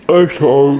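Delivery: ordinary delivery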